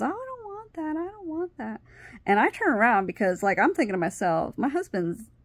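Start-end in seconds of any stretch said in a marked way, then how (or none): 0.0-1.8 high, soft mimicked whine; mimicked refusal. Man refusing a Hershey bar, high soft mock refusal. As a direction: imitate him refusing in a small high voice.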